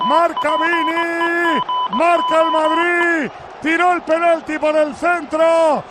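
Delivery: slow, drawn-out shouted goal call; breakneck goal commentary. Real, not acted. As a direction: stretch each word, celebrating the goal. Goal commentary after the penalty.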